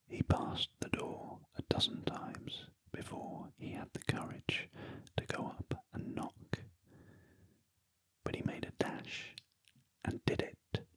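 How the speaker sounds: ordinary delivery, nothing marked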